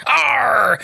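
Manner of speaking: whispered pirate growl; breathy pirate arr, up high. Pretend. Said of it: "Why am I talking like a pirate?".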